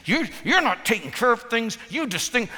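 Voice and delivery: high-pitched mock nagging complaint; acted accusation. Wife complaining her husband neglects things, acted accusation. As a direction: scold him as an aggrieved wife.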